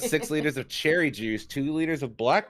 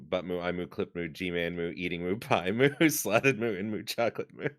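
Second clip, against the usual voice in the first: low moo talk; 5 semitones lower, 4 dB quieter than usual. Pretend. Inserting Moo after every word.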